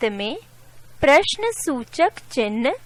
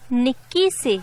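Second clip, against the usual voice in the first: ordinary talk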